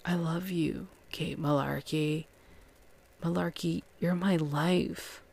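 soft, hushed imitation of a lover; softly acted sweet talk; mock tender declaration. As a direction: murmur it like a retold love confession. Retold intimate love declaration.

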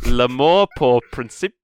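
hammed-up French accent, each phrase lifting high at the end; slow exaggerated French reading with a laugh. Shown as a demonstration. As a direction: read it slowly like a mock Frenchman, rising on every ending. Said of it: "you're going so high at the end of every bit".

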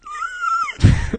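very high mock crying wail; forced fake sob. Pinching himself to cry, forced fake wail. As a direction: squeal out a badly faked sob.

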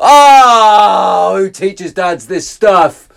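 loud oh, then slow disbelieving question; exasperated. Real, not acted. Host mocking dads, slow exasperated aside. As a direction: throw up your hands at it.

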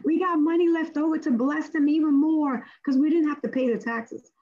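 high, excited relief; excited praise. Retold or imitated. Travellers rejoicing over the saved tax money, excited. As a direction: cheer it like a windfall.

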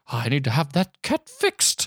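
put-on lady voice; lightly voiced fussy cat owner; older sorority lady. Sorority lady grumbling about the cat, put-on voice. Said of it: "She doesn't sound like that at all".

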